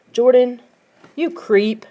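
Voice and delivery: a touch of angry scolding; mild anger. A narrator's reading. Friend scolding the prankster, mildly angry. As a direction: scold him, lightly angry. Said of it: "Nicole declared angrily".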